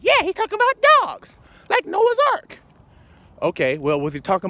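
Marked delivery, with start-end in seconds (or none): 0.0-2.6 much higher, silly naive voice; mock listener. Preacher imitating a naive listener about dogs, mocking. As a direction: play the clueless believer. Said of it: "You might be like".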